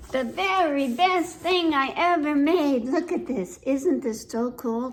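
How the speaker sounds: put-on mildly huffy little girl talking to Stanley; lightly voiced little girl. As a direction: read it as a pouting child who won't play. Girl showing off creation.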